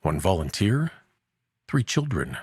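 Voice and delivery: ordinary delivery, nothing marked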